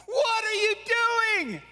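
high, shouted jeering from shore; laughing, taunting shout across the water; mocking fishermen. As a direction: call out to ridicule him. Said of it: "They're like".

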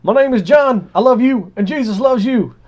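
high, loud hammed-up hollering; half-mocking shout of love. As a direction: call it out like a missionary on a beach. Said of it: "And then I hollered".

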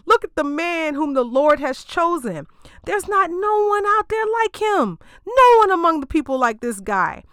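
high, grand proclaiming voice; Samuel's put-on prophet voice. Samuel presenting Saul to the people, high and grand. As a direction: announce the chosen king to the crowd. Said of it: "I don't know why I'm giving Samuel that voice".

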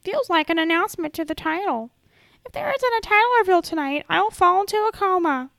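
high, loud mocking whine; mocking whiny fan. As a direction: parody an overexcited fan. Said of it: "the first thing I see is".

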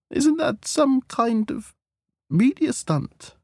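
slow, very high-pitched query; doubtful questioning. Acted. Hostess doubts the news, questioning.

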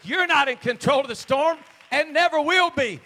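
high, loud declaration; full-force preaching. Shown as a demonstration. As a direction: belt it out to the congregation. Same line repeated at full force.

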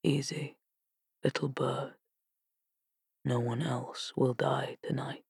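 soft unvoiced whisper. A narrator's reading. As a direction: whisper it close at her ear. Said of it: "Death whispered beside her".

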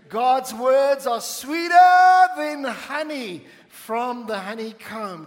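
drawn-out preacher's shout; exultant preaching. Real, not acted. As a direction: belt it out, stretching "sweeter". Preacher's climax on the point.

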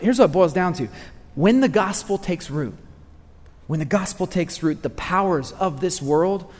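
ordinary delivery

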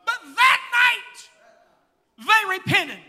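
loud, fervent preaching. Acted. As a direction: preach it with full fire. Preaching at full force.

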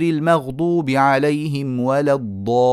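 slow melodic tajweed recitation; Quran recitation. Shown as a demonstration. Teacher reciting the verse to the students. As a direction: recite it slowly, drawing out the vowels.

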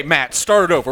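usual speaking voice

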